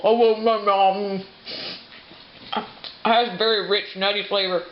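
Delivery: talking through a mouthful of bread; chewing while talking; mouth full. Real, not acted. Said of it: "I'll talk with my mouth full".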